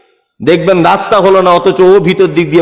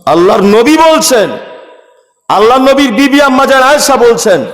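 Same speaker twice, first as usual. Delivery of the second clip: high, rising sermon call; impassioned preaching; 5 semitones higher than usual. Acted. Preacher introducing Aisha's words, high declamation. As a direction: announce the holy speaker to the crowd.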